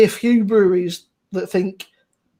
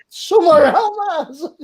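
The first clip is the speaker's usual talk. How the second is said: laughing quote; 8 semitones higher, 4 dB louder than usual. Retold or imitated.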